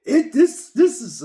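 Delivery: high, loud retold cry of no; imitating a desperate high shout; anguished cry. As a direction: cry out over a fallen friend. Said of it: "He goes".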